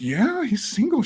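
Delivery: high-pitched eager retold assurance. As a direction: act out an eager kid vouching to mom. Child eagerly vouching to mom.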